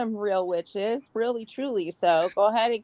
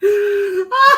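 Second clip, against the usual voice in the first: high loud crying laugh; loud high shriek of laughter; 12 semitones higher, 10 dB louder than usual. Real, not acted. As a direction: laugh till it squeals. Big laugh.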